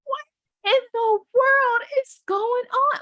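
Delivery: high, slow stretched astonishment; bewildered shout of wonder; amazed disbelief. Retold or imitated. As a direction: drag it out in awe, way up high. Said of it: "I'm like".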